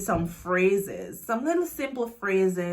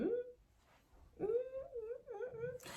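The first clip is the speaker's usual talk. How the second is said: high, soft drawn-out mmm of delight; savouring hum; 15 dB quieter, much slower than usual. Real, not acted. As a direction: hum like tasting something delicious. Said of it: "creamy matte lip".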